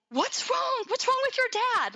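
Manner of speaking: high-pitched worried friend, imitated; retold alarm. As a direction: mimic a friend's panicked questions, pitch way up.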